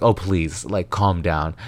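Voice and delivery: ordinary delivery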